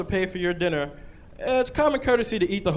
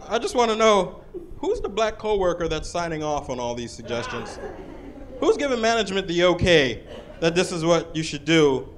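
ordinary talk, nothing marked

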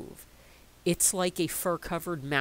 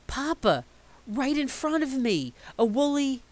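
put-on excited child; 9 semitones higher, 6 dB louder than usual. Child runs breathless to father.

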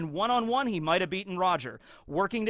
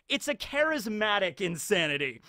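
ordinary talk, nothing marked

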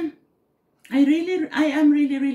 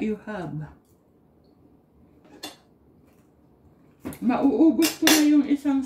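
talking while chewing; speaking around food; mouth partly full. Real, not acted. Seen 0: Bite taken at -4; jaw and lips still working while she talks.

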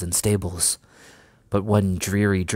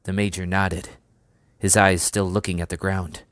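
ordinary delivery, nothing marked

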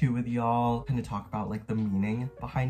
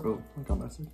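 ordinary delivery

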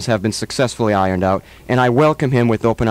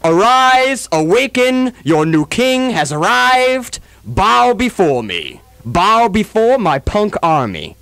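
booming high proclamation, slow; dark lord; 9 semitones higher, 3 dB louder, much slower than usual. Pretend. Proclaiming as conquering king.

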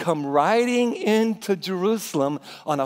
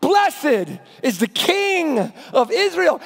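slow, raised proclamation; proclaiming; 8 semitones higher, 8 dB louder, much slower than usual. Acted. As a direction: announce the king. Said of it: "these words".

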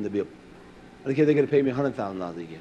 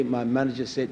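normal talking voice

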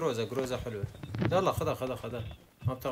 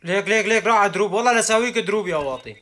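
excited callout; 5 semitones higher, 9 dB louder than usual. Real, not acted. Spots enemy, excited callout.

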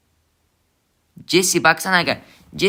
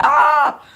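sudden high, loud "aa" cry; surprised yelp; 13 semitones higher, 6 dB louder than usual. Real, not acted. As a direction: jolt out a startled yelp. Sudden shock mid-game.

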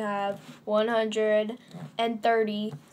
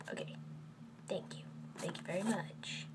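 whisper; 4 semitones lower, 16 dB quieter, faster than usual. Acted. Whispered thanks to viewers.

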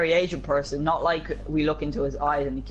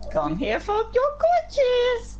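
high coaxing call; slower than usual. Pretend. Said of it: "Did you just say".

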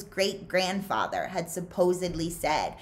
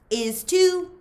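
high, loud "is too" shot back; loud, high playground contradiction; childish retort; 12 semitones higher, 7 dB louder than usual. A narrator's reading. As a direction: snap it back like a stubborn kid. Zigzag arguing with Magnet.

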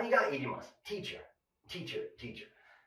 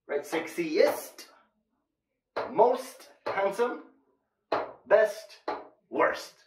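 slow drill; 4 semitones higher, much slower than usual. Shown as a demonstration. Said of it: "drilling superlatives slowly".

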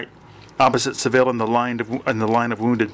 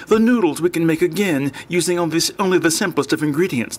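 put-on higher-pitched, lightly winded old man; panting old man; 6 semitones higher than usual. A narrator's reading. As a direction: speak as a slightly breathless old man. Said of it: "the old man panted".

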